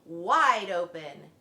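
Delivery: loud, slow drawn-out order; wife yelling at the fox; yelled. A narrator's reading. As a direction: bellow it slowly. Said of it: "yelled his wife".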